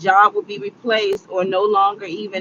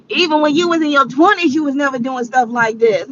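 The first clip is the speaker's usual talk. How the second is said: inner scolding voice; 6 semitones higher, 4 dB louder than usual. Retold or imitated. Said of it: "my brain was like".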